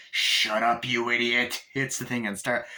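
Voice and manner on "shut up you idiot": Chucky. Retold or imitated. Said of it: "And then Chucky's like".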